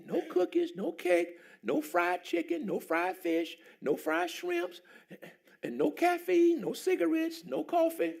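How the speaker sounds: high, searching preacher's cadence; preaching. Acted. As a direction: lay out the rules of the fast. High preaching run on the fast.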